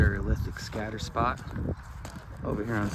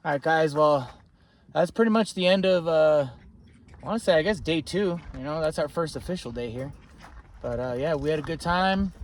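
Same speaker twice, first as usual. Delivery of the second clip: upbeat; 6 semitones higher, 4 dB louder than usual. Real, not acted. Upbeat sign-off to camera.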